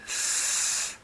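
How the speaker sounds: a soft, thin hissed "S"; clean s sound. Shown as a demonstration. Teacher showing how clean the s sound is, soft hiss. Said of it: "la S es un poco más limpia".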